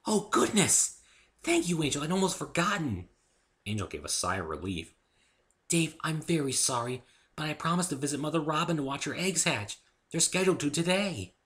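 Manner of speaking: high, sudden polite fluster; flustered Fluttershy. Acted. Fluttershy introduces Dave to Angel, high and flustered. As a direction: catch yourself and make introductions.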